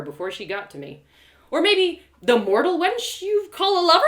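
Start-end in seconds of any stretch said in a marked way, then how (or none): 1.5-4.0 high, loud jeering taunt; cruel taunt. Acted. Narrator jeering at Trinia about her lover, loud taunt. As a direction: go for the wound.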